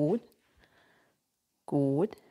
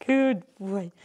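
affectionate praise. Real, not acted. Cooing to dog.

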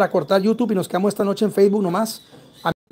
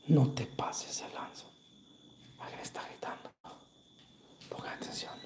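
susurro; 5 semitones lower, 13 dB quieter than usual. Real, not acted. Susurro en investigación paranormal.

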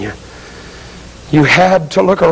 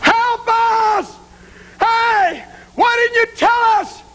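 high-pitched cry for help; anguished, pleading yell; desperate yelling; slower than usual. Acted. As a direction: wail it reproachfully to someone out of reach. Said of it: "They're yelling at you".

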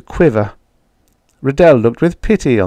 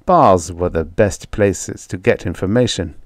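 ordinary delivery, nothing marked

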